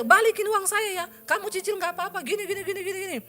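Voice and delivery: high, loud insistent plea to pay back; loud pleading demand. Retold or imitated. Texting the builder who took the money, a loud high plea. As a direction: push him hard to pay it back.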